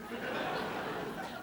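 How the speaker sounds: brief quiet laugh; soft laugh. Real, not acted. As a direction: give a short soft laugh. Laughs at her tea line.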